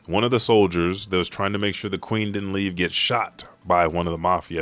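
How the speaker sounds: usual speaking voice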